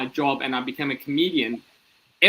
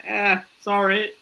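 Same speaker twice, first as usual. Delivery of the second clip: sheepish apology; 7 semitones higher, slower than usual. Retold or imitated. Sheepish own reply, acted out.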